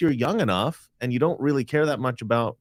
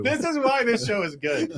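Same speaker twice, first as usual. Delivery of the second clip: laughing; 12 semitones higher than usual. Real, not acted. Laughing after joke.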